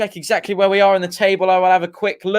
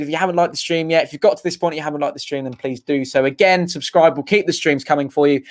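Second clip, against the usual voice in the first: usual speaking voice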